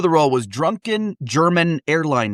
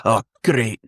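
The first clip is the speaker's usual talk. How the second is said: brief Scottish anchor word; quick demo of Scottish sounds; Scottish accent. Shown as a demonstration. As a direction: say it as a Scot would. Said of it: "Scottish. You know, I, I just say".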